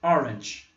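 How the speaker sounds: Orange said with a clear English tch ending. Shown as a demonstration. Teacher modeling Orange for students, careful English. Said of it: "O som aqui é tch".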